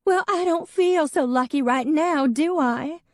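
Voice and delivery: a grieving ex snapping at Em; tearful snap. A narrator's reading. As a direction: bite back through the tears.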